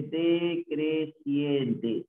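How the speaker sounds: "decreciente" chanted out syllable by syllable; very slow, measured "decreciente"; slow sounding-out. Shown as a demonstration. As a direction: stretch every syllable of "decreciente". Teacher stresses the term.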